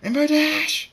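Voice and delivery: high, loud furious-sounding scream; screamed name. A narrator's reading. Trixie yells after the passing flier, screaming. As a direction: scream the name, stretching the end. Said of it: "She screams".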